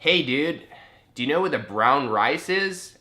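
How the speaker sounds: translated Canadian accent. Shown as a demonstration. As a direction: ask it in an exaggerated Canadian accent. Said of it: "translate my accent from Australian to Canadian".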